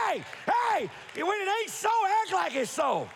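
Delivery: rally the church, voice up high; preacher's call-out. Real, not acted. Preacher calls out to congregation.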